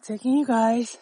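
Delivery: breathing hard while hiking; winded from the incline; heavy breathing. Real, not acted. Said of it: "Pardon my breathing".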